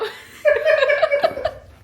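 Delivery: high, loud laughing fit. Real, not acted. Wife laughing at viewers teasing her, high loud laugh. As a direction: let a big high laugh out.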